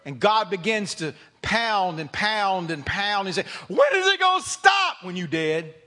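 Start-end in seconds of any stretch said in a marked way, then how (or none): 3.7-5.0 high, loud desperate cry; anguished shout. Acted. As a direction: cry out at the pounding. Crying out under God's pounding.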